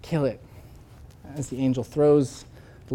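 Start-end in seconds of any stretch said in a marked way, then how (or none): 0.0-0.4 broken surrender. Retold or imitated. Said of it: "finally says".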